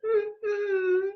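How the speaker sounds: high whining dog whimper; puppy whimper. Acted. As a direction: whimper like a sad little puppy. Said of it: "The little dog whimpered".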